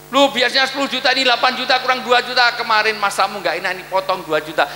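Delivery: mock petty husband deducting money; nagging husband. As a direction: scold the wife over her pay cut. Husband docking wife's allowance.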